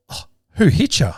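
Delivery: high, inquisitive kid imitation; lightly voiced boys. Sons asking dad who hit him, curious. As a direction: lift the pitch for a curious son. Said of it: "And they're like".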